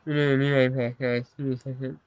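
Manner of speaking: usual speaking voice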